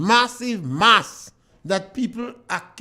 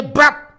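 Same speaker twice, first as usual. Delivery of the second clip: a sharp, louder, higher "Brap" like a body dropping; mock sudden thud; 4 semitones higher, 6 dB louder than usual. Radio host mocking how suddenly men drop dead, sharp louder mock thud. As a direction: spit out a quick burst of noise. Imitating a sudden death noise.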